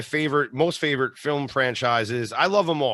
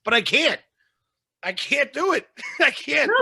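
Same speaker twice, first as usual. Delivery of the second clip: helpless protest; 11 semitones higher than usual. Real, not acted. Protests wife's request to stay calm.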